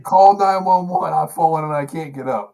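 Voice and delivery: put-on high, loud, slow old-lady cry for help; old lady emergency ad. Host parodying the old medical alert ad in a high, loud, slow voice. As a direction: ham up the fallen elderly woman from the ad.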